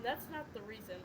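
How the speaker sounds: plain reading voice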